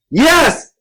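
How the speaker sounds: loud, very high scream. A narrator's reading. Child answers the creature while running away, screaming. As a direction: scream it out in fright. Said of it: "I screamed as I ran".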